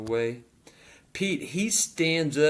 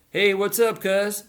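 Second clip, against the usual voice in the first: cocky greeting; 7 semitones higher, 6 dB louder than usual. A narrator's reading. Henry's cocky greeting after beating.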